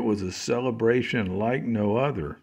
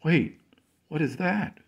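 surprised; 8 semitones higher than usual. Acted. Sudden discovery.

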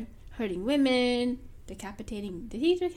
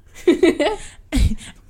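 loud laugh breaking out; high giddy laugh; bursting laugh; 4 semitones higher, 10 dB louder than usual. Real, not acted. Laughing at the fake accents.

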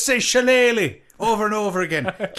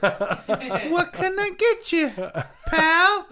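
high, slowed-down bartender voice; mixologist character; 8 semitones higher than usual. Pretend. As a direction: play a drawling, high-pitched mixologist. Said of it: "call him a mixologist".

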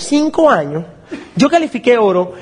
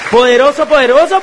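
louder and higher with each repeat; building emphatic call; 5 semitones higher, 4 dB louder than usual. Real, not acted. Speaker pumping up his team, building louder. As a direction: ramp it up like a rally cry. Repeats word to hype audience.

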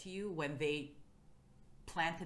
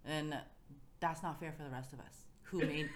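ordinary talk, nothing marked

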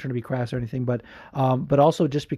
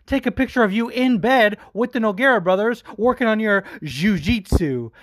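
higher, cocky trash-talk voice; Chael Sonnen impression; 6 semitones higher, 3 dB louder than usual. Imitating Chael taunting Vanderlei, cocky and mocking. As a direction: ham up a smug gangster threat. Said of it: "Ariel turns over to Chael and is like".

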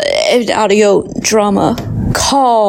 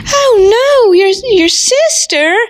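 soap-opera gasp at a reveal; high melodramatic disbelief; hammed-up shock; 9 semitones higher than usual. Colleague in teacher drama.

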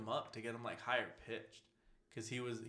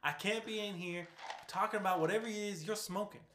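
put-on lightly done Texan accent, pitched up; Preacher's Southern accent; 9 semitones higher than usual. Preacher scolding Cassidy, light Southern accent. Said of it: "I would say it just like Preacher".

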